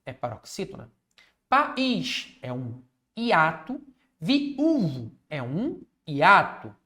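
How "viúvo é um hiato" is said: ordinary delivery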